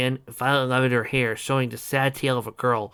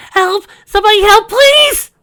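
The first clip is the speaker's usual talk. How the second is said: high, loud desperate scream; screaming for help; 15 dB louder than usual. Acted. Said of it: "started screaming".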